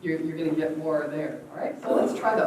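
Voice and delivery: normal talking voice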